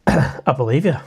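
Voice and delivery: usual speaking voice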